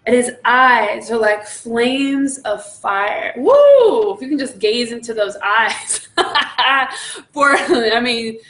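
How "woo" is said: high, loud "woo"; long ringing whoop of praise; excited whoop. Real, not acted. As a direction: whoop it out, overcome with delight. Overcome while describing the eyes.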